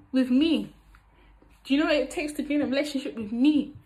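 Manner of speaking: usual speaking voice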